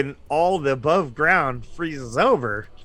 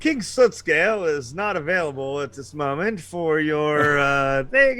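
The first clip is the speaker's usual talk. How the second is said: higher-pitched, hesitant official voice; apologetic diplomat; 4 semitones higher than usual. Retold or imitated. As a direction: play a fussy envoy politely stalling. Kobold diplomat stalls visitors.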